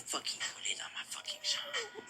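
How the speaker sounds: breathy whisper, no voice; whispered echo. Real, not acted. Rapper echoes his own line in a whisper.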